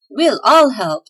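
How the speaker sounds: loud eager group shout; elves shouting. Acted. Elves offering to help Claus, loud eager shout. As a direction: call out together, keen to help. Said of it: "shouted the elves".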